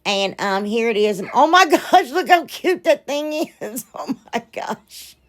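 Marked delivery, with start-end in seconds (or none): 1.2-5.2 giggling, higher pitched oh my gosh; laughing delight. Real, not acted. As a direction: laugh through it, thrilled with the result. Delighted at her finished terrarium.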